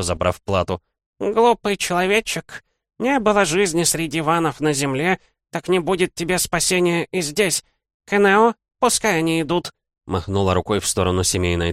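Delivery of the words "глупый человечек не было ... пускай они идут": put-on old hag voice. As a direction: sneer like an ancient hag. Old demon hag speaks. (голос злобной старухи)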